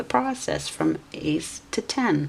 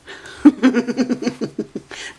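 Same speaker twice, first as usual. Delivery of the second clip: a short high laugh at her own slip; laughing at herself; 7 semitones higher, 4 dB louder than usual. Real, not acted. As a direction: laugh it off at yourself.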